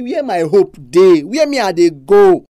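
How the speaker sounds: slow, loud, pleading cry of "Where my hope dey?"; desperate loud plea. Retold or imitated. Preacher voicing lost people crying out, slow and loud. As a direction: stretch out "Where" and call it out loud in despair. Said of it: "So kind time you say".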